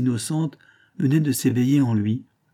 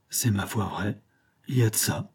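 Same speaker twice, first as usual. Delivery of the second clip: softer, deflated admission; crestfallen sigh; 4 dB quieter than usual. A narrator's reading. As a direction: concede it with a sigh. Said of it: "soupira la morandière ... d'un air déconfit".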